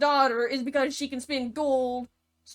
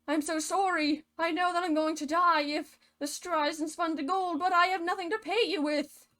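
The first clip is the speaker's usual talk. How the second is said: higher-pitched, apologetic damsel; mock pleading; 6 semitones higher than usual. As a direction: plead helplessly, climbing in pitch. Distraught daughter pleads with little guy.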